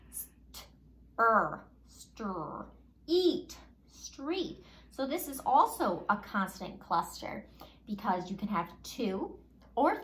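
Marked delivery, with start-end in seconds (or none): 0.1-4.6 "St", "er", "stir", "eat", then "street" blended; blending street. Shown as a demonstration. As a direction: build "street" from its sounds. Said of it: "the R that makes the R sound".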